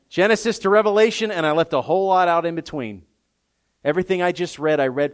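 loud, high, rising scripture proclamation; preaching at full volume; full-force preaching. Acted. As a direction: thunder the promise from the pulpit.

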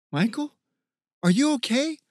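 anxious high voice, rising at the end; concerned. A narrator's reading. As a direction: check on him with urgent concern. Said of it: "A wave of concern".

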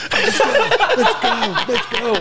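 hammed-up squeaky "let's go" chant; hyped teammate. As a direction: pump everyone up like an overexcited trainer. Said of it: "He's like".

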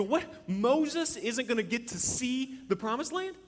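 loud, high-pitched incredulous outburst; acted disbelief. Believer hearing Moses's punishment, acted shocked disbelief. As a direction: stretch it out in exaggerated shock.

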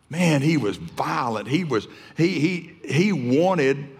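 normal talking voice

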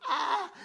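high-pitched freaked-out "ah!"; mock yelp of a kid in a panic; panicked yelp. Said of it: "he is freaking out".

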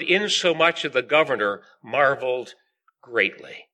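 measured, projected Bible reading; scripture reading. Acted. Preacher reading the Gospel of John to the meeting, solemn scripture reading. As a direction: read the verses out firmly to a congregation. Reads scripture aloud to congregation.

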